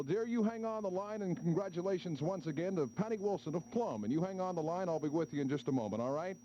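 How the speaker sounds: ordinary delivery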